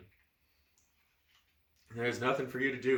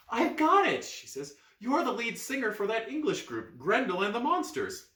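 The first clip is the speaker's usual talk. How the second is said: excited; 11 semitones higher, 4 dB louder than usual. Acted. Girl excited recognition.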